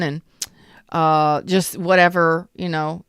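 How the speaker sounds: usual speaking voice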